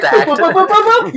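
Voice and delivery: quick high-pitched boop noises; little cartoon pops, rising high; playful imitated popping sound. Imitates eyeballs popping into view.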